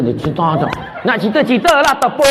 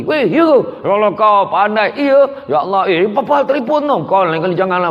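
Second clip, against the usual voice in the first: lively chatter; 6 semitones higher than usual. Retold or imitated. Husband chatting lively with friends.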